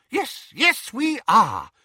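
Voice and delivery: put-on high-pitched, hurried-sounding old-fashioned gentleman; flustered wizard. A narrator's reading. Diggle answering an impatient uncle, high and flustered. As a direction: answer in a high, fussy character voice.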